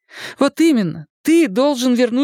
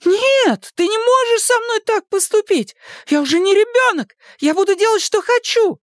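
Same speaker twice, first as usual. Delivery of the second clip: high-pitched indignant outburst; outraged protest; 11 semitones higher than usual. A narrator's reading. Daughter defies father's decision, outraged protest. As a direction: protest like a thwarted teenager.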